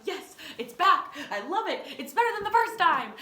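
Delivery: high, loud triumphant gloat with laughter; gleeful gloating. Retold or imitated. Girl gloating at getting the chair back, high and gleeful. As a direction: crow over your win.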